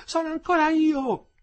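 high, loud, carrying call; woman shouting out. A narrator's reading. Prisoner's wife calling for another's wife, shouting. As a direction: call out slowly and loudly to those inside.

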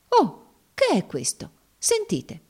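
high-pitched alarmed hush-and-listen cry; startled exclamation. A narrator's reading. Slave woman alerting her master to a noise, exclaiming. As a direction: call sudden attention to a noise, high. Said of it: "esclamò col dito in alto".

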